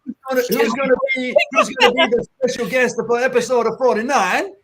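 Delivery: hammed-up Brazilian accent impression. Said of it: "in her amazing Brazilian accent".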